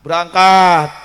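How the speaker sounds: a single word thrown out loud; loud sermon call. Acted. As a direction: announce the departure with a big push. Preacher calls out departure.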